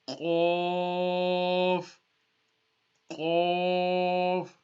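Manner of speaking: Arabic Qaf from the throat; thick Qaf. Shown as a demonstration. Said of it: "top part of the throat".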